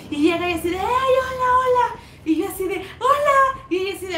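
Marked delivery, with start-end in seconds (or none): 0.8-2.0 high, louder sing-out hello; imitated gushing greeting. Elida arriving at the stand, imitated gushing greeting. 3.0-3.6 high, loud, held hello; mock-cheery greeting. Answering Elida at the stand, mock-cheery greeting.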